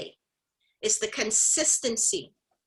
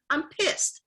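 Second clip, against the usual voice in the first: heated; 6 semitones higher than usual. Real, not acted. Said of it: "and I'm heated".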